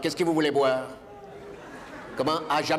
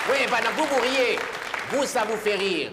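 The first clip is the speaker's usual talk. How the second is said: mock indignant; 7 semitones higher than usual. Real, not acted. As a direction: tease the laughing audience. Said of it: "addresses laughing audience".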